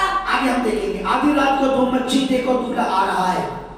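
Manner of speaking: loud, high, slow proclaiming; fervent scripture preaching. Acted. Pastor reading the parable aloud, loud, high and slow. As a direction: thunder the parable to the church.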